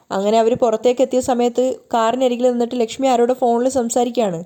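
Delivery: ordinary delivery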